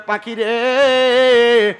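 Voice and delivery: loud, long-held answering call; drawn-out call. Acted. Prophet answers the bird, long drawn-out loud call. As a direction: stretch the last syllable out, loud.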